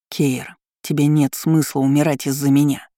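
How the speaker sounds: ordinary delivery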